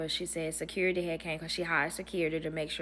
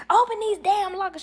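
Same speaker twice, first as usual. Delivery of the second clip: high, loud imitation of irate relatives; mock-shout the order, pitched way up; hammed-up outraged demand; angry demand; 13 semitones higher, 7 dB louder than usual. Retold family demanding their belongings.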